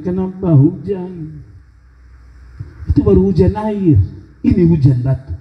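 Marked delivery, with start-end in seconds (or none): none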